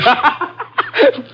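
high mocking cackle; jeering, high laughter; scornful laugh. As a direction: burst out laughing at a prayer. Gunman scorns the prayer.

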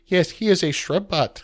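a hint of a prim robot. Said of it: "And Val says".

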